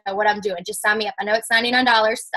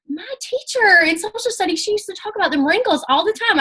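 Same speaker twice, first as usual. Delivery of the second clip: much higher, eager self-quote; excited idea; 10 semitones higher than usual. Retold or imitated. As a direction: pitch it up like a sudden bright idea. Retold excited idea to message teacher.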